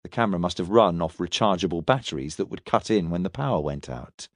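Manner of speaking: low, restrained reply, dropping away at the end; through gritted teeth. A narrator's reading. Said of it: "Milton gritted his teeth".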